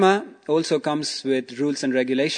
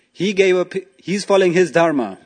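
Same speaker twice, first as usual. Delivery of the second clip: higher and louder, earnest defence; firm bear; 6 semitones higher, 6 dB louder than usual. Acted. Bear defending the hunter to the tiger, raised earnest voice. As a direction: stand up for him with conviction.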